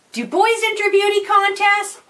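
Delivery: high-pitched mock yell; boy calling out. Billy Boy calling to Mom about the contest, mock yell. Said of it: "he screamed out to Mom".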